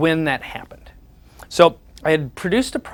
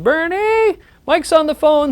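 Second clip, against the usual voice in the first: high, louder call across the yard; 13 semitones higher, 5 dB louder, slower than usual. Retold or imitated. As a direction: call him in from the garden. Mom calling dad from door.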